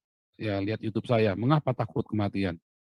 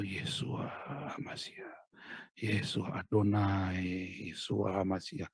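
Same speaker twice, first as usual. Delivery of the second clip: hushed Hebrew prayer; 6 dB quieter, much slower than usual. Shown as a demonstration.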